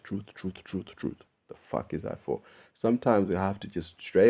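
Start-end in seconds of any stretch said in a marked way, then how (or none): 0.0-1.2 whispered repeated truth, trailing away to nothing; whispered echo effect on truth; fading echo. Acted. 1.5-2.4 whispered aside. Real, not acted.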